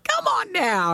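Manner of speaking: slow, high game-show call; infomercial voice. Pretend. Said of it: "Sounds like an infomercial".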